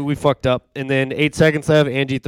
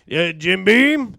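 slurred tailgater voice; 9 semitones higher, much slower than usual. Retold or imitated. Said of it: "tailgater that was like".

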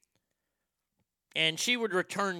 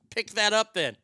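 grandma's command; 6 semitones higher, 6 dB louder than usual. Retold or imitated. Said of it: "She goes".

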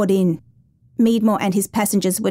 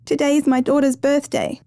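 higher, pleading narrator's voice; begging; 6 semitones higher than usual. A narrator's reading. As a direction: plead with a restrained, raised pitch. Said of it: "Judith begged".

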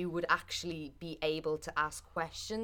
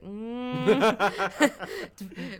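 laughing, higher and much louder; mock suspicious; 7 semitones higher, 12 dB louder, much slower than usual. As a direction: playfully accuse the cat of scheming. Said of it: "I was like".

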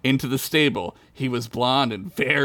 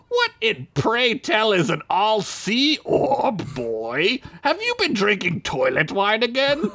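pompous elf; 9 semitones higher, slower than usual. Acted. Elf mocking Ugla.

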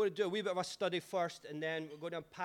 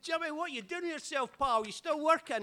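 much higher, loud, slurry pub voice; drunk Scotsman; 12 semitones higher, 7 dB louder than usual. Retold or imitated. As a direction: drunk pal asking questions, hammed up. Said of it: "He's like".

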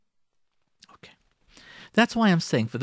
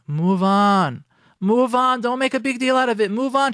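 high, raised, insistent plea; exasperated exhortation, pitched up; emphatic urging; 7 semitones higher, 4 dB louder than usual. Real, not acted. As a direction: push them hard to let it go. Urging husbands to drop it.

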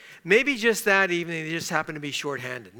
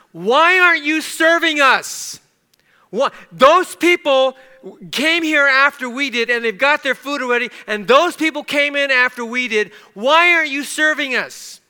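high, very loud indignant demand; loud public protest; 9 semitones higher, 10 dB louder than usual. Retold or imitated. Angry diner confronting servers at Denny's, shouting for all to hear. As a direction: shout it across the whole restaurant. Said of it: "in a voice loud enough for everyone".

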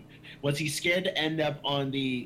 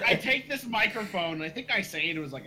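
usual speaking voice

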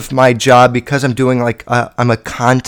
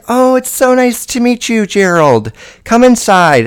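high, welcoming parent greeting; sweet mom voice; 7 semitones higher than usual. Retold or imitated. Girl's mother welcomes the date, high and sugary. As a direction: gush like a charmed mother at the door.

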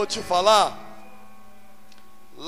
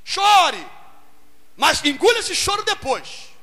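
urging cry; 8 semitones higher, slower than usual. Real, not acted. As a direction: order them to weep. Preacher urges the church.

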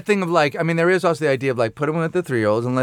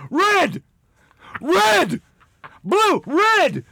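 much higher, shouted single words; mock color drill; much slower than usual. Dad quizzing his son with crayons, mock shouting. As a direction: bark each color like a drill sergeant. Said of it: "And you go".

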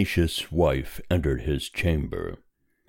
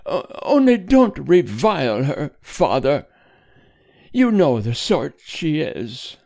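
high, hesitant, entreating voice; timid appeal; 12 semitones higher, 5 dB louder than usual. A narrator's reading. Wife entreating husband not to revile daughter, timid appeal. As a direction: plead nervously, pitched right up. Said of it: "in an appealing, timid voice".

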